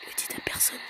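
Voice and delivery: hushed mock-secret aside; confiding whisper. Gamer confessing he nearly cried over his save, secretive whisper.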